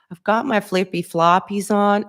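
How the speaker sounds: imitating an Australian friend's slang in a shaky Australian accent; bad Australian accent. Quoting her old Australian friend in a self-admittedly bad Australian accent. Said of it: "my accents are not good".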